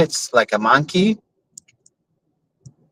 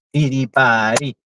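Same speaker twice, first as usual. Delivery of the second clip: normal talking voice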